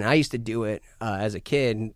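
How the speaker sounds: usual speaking voice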